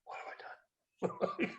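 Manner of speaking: very soft with a high squeak at the end; nervous dread. Retold or imitated. Man panicking quietly after agreeing to the coach. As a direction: gulp at what you just agreed to. Sudden regret after hanging up.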